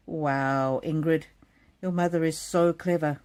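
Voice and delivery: ordinary delivery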